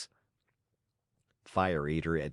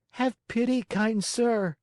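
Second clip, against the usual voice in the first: much higher, begging puppet voice; pleading Pinocchio; 14 semitones higher, 4 dB louder than usual. A narrator's reading. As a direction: beg like a frightened little boy.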